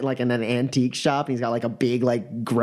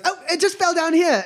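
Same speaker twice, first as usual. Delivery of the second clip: high-pitched feigned innocence; excusing trickster. Acted. Magician covering for the missing hummingbird, high excuse. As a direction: pipe up with a cheat's excuse. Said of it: "he goes".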